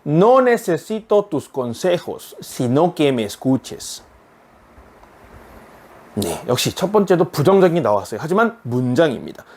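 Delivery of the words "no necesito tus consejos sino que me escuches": very slow, careful Spanish sentence; slow teacher read-aloud; slow model reading. Shown as a demonstration. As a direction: read it slowly so students can follow. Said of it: "일단 읽어보겠습니다".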